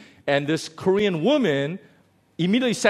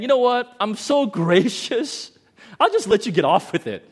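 mock gracious; 7 semitones higher, 5 dB louder than usual. Mocking false graciousness.

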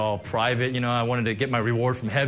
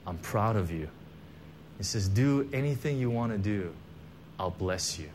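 hushed, lower and much softer; voice of God; 5 semitones lower, 7 dB quieter than usual. Retold or imitated. Pastor retelling God's words to him, hushed and tender. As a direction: say it gently, like a voice from heaven. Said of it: "And the Lord says".

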